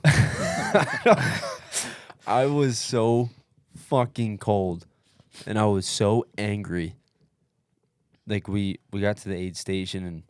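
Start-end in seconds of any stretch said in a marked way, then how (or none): 0.0-1.2 breaking into a high laugh. Real, not acted. Laughs while recalling misery. 2.3-6.9 emphatic. Real, not acted. Recalling freezing and fury.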